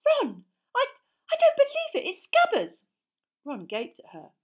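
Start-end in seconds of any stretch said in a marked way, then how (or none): 0.0-2.9 high, loud shocked cry; shrieking surprise. A narrator's reading. Hermione finding the rat in the jug, shrieking high and loud. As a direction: squeal it out in disbelief. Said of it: "she let out a shriek".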